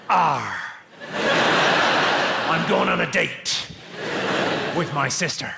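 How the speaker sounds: hammed-up West Country pirate voice; slow, drawn-out mock West Country pirate. Shown as a demonstration. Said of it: "West Country is just a pirate voice".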